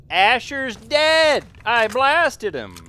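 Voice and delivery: loud, high, drawn-out disbelieving shout; frustrated yell. Real, not acted. Frustrated arguing with game character.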